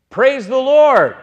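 loud, high, drawn-out proclamation; exclaimed praise. Real, not acted. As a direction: call it out slowly to the whole congregation. Preacher calls out answer to congregation.

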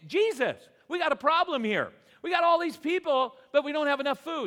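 hammed-up cry for help to Jesus; pleading with Jesus over the food shortage, high and loud; excited plea.

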